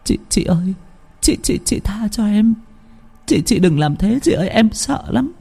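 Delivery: high, stammering, trembling plea; terrified pleading. Acted. Út Phượng begging her sister-in-law to stop, terrified high plea. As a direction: beg her sister-in-law, stuttering with fear. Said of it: "nói như là van xin".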